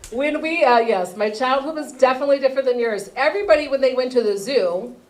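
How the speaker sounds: ordinary talk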